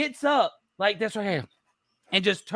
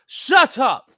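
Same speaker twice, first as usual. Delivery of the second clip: loud, high retort; hammed-up angry outburst; mock yell; 3 semitones higher, 6 dB louder than usual. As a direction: snap it out loudly as an imitation. Repeated imitated angry retort.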